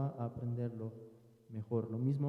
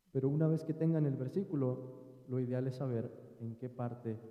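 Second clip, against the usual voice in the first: ordinary talk, nothing marked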